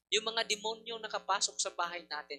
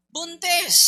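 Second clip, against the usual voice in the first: loud, high punch on Buntis; emphatic exclamation; 10 semitones higher, 12 dB louder than usual. Real, not acted. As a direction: shout the word Buntis in disbelief. Exclaims pregnancy loudly.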